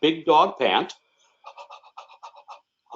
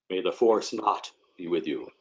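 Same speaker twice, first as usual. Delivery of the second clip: low, soft movie-villain voice; movie quote voice; 7 semitones lower, 7 dB quieter than usual. Acted. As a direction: drop low and quiet for the quote. Joking twist on movie quote.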